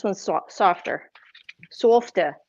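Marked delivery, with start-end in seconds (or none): 1.7-2.4 Boston accent. Shown as a demonstration. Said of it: "It's my Boston accent".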